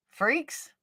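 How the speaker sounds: soft, high scornful jab; muttered insult. Real, not acted. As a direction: toss it off with contempt. Dismissive jab after retort.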